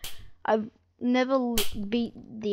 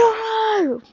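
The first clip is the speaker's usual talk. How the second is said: dismayed shout; 11 semitones higher, 6 dB louder than usual. Real, not acted. Caught by Baldi.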